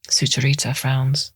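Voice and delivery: usual speaking voice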